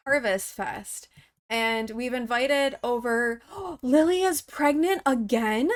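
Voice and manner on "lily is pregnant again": gasp, then ask it in disbelief; surprised gasp. Real, not acted. Gamer discovering her sim is pregnant, surprised. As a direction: gasp and rising question of disbelief.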